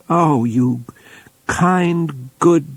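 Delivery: overwhelmed by the proposal she imagines; gushing landlady. A narrator's reading. Landlady sobbing with joy at Mister Pickwick, high and gushing. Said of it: "with a cataract of tears".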